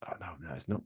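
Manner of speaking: whispered self-correction; whispering. Real, not acted. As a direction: whisper it to yourself. Muttering to self.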